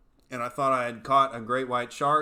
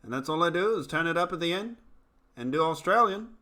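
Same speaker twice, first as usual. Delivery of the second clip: higher-pitched New Zealand accent, lilting up at line ends; 5 semitones higher, 5 dB louder than usual. Shown as a demonstration. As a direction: put on New Zealand by doing Australian with questioning endings. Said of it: "Can you give me a New Zealand accent".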